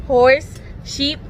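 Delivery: ordinary delivery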